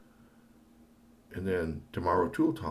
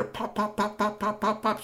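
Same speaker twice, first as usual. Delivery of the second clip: popping sounds; 4 dB louder than usual. Acted. Imitating circuits snapping.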